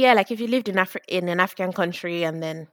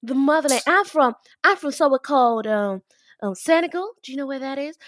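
put-on higher gushing American accent; mock American accent; 4 semitones higher than usual. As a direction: play the eager American tourist. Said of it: "Shakwara accent".

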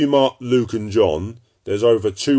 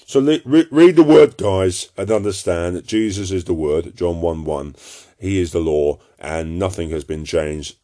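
usual speaking voice

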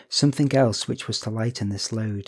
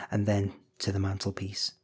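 ordinary delivery, nothing marked

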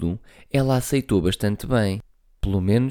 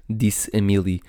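ordinary delivery

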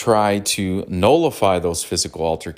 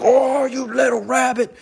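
a much higher hammed-up cartoon shout; furious cartoon cowboy. Cowboy villain losing it at the rabbit, mock shout. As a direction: yell like a hot-headed cartoon cowboy. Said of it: "would get all pissed off all the time".

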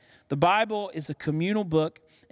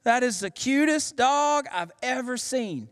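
gushing dog lover; 8 semitones higher, slower than usual. Retold or imitated. Strangers on the street gushing over the pastor's puppy, slow and high. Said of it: "and they say".

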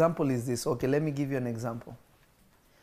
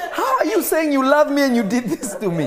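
put-on higher, louder, laughing complaint of a sore kid; mock child protest; 11 semitones higher, 7 dB louder than usual. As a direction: whine it like a punished child, laughing. Child's protest after a whooping.

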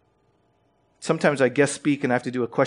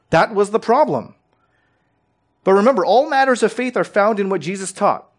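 raised, louder, higher preaching voice; 4 semitones higher, 6 dB louder than usual. Acted. Preacher pressing the congregation on Scripture, fervent. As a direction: build to full pulpit force.

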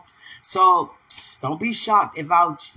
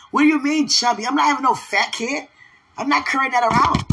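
higher, louder retold protest; mock indignation; 7 semitones higher, 3 dB louder than usual. Woman arguing back at God over chubby, mock indignant. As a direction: object like you are mildly outraged. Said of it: "I was mad at God".

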